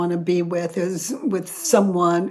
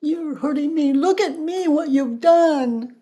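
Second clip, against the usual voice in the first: mock wounded victim; 8 semitones higher, 3 dB louder than usual. Facilitator mocking the victim persona at her partner, hammed-up hurt. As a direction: ham up the wronged victim pleading.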